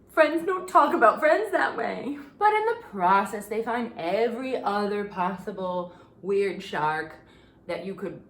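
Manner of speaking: usual speaking voice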